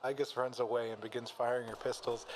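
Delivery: ordinary talk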